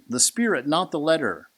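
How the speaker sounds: fiery scripture reading. Acted. Reading Paul's letter to the congregation, booming and high. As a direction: thunder the apostle's words from the pulpit. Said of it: "He says".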